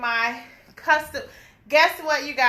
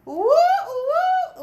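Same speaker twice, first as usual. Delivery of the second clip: high delighted whoop; excited whoop; 13 semitones higher, 3 dB louder than usual. Real, not acted. Vlogger whooping over her new custom shirt. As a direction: cheer like you just won.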